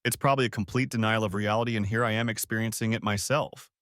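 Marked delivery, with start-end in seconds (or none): none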